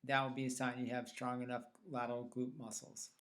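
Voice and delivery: ordinary talk, nothing marked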